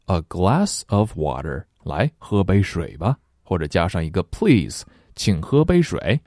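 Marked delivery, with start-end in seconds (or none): none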